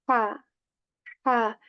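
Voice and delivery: "ha" with air pushed from the middle of the throat; "ha" as a breathy h from the throat, then the vowel. Shown as a demonstration. Teacher demonstrates the middle-throat ha for students. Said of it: "pushing the air out of your throat".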